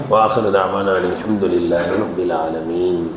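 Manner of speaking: low, soft, steady recitation of the dua; Arabic closing supplication, chanted low and soft; closing prayer. Acted.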